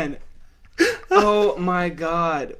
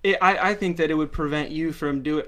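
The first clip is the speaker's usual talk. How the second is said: usual speaking voice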